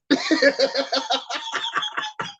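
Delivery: high-pitched burst of laughter. Real, not acted. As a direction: let out a high giggle. Laughing at own joke.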